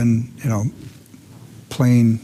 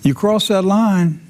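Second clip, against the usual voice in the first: higher-pitched warning called from a porch; mock shout; 9 semitones higher than usual. Homeowner warns gang from his porch, mock shout. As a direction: call out a threat without full volume. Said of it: "you're shouting from your porch".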